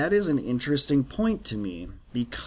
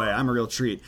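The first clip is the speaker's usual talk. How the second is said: ordinary delivery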